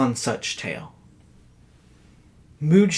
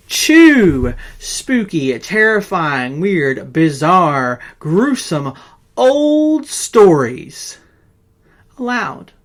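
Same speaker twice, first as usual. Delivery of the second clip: slow, drawn-out, higher and louder, savouring each word; hammed-up spooky; 3 semitones higher, 7 dB louder, much slower than usual. Host promising viewers weekly scary stories, hammed-up spooky drawl. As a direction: roll out every creepy adjective like a horror host.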